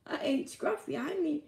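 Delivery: rising disbelief breaking into a laugh; high laughing yelp at the end; horrified realization. Retold or imitated. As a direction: climb in pitch as it sinks in. Realizing he ate dog.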